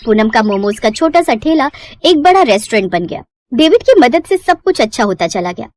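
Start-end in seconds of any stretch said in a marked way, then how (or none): none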